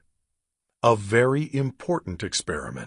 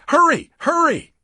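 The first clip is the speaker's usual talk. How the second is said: very high, loud panicked shriek; 6 dB louder than usual. Acted. Gabby shrieking at Drake to hurry, very high and loud. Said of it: "she shrieked".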